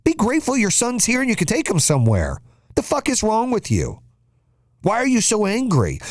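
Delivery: high-pitched, heated telling-off; an indignant dad scolding another parent; scolding rebuke. Acted. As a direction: snap back at a whiner in disbelief. Rebuking the imagined complainer.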